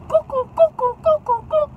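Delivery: high sing-song cuckoo hoots; cuckoo call. Retold or imitated. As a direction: mimic a cuckoo clock, very high. Imitates cuckoo for crazy girl.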